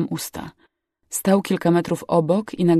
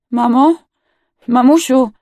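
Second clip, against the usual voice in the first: high, loud questioning cry; pleading call; 7 semitones higher, 9 dB louder than usual. A narrator's reading. Injured girl seeks her mother, pleading call. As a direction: call out anxiously, begging an answer.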